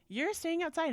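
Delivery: mother retelling how she told her daughter off; stern mom. Retold or imitated. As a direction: lay down the law to the daughter. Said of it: "I'm like".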